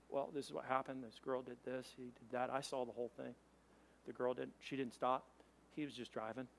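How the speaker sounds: hushed, low retelling of an interview; murmured back-and-forth, played down; low-key witness statements. Police question witnesses at the crash, quiet and low.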